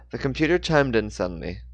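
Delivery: usual speaking voice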